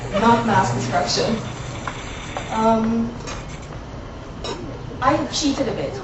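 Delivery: ordinary talk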